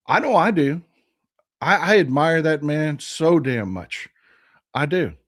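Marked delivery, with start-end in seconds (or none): none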